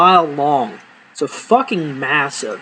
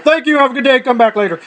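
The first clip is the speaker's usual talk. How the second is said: rushed fake cheer; 7 semitones higher, faster than usual. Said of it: "I'm like".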